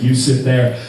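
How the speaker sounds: put-on low, blunt Romanian-accented order; Pavel's Romanian accent. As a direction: bark it like a huge Romanian bouncer. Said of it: "he said".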